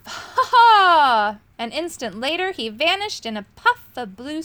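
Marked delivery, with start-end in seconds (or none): 0.1-1.4 higher, much louder gloating laugh; triumphant villain laugh from a swirling cloak; villain's laugh. A narrator's reading. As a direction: cackle as he vanishes. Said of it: "began to laugh".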